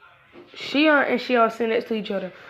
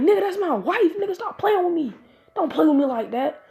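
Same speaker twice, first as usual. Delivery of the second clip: much higher, heated warning; offended warning; 8 semitones higher than usual. Acted. Husband to friend insulting his wife, heated. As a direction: warn him off hotly.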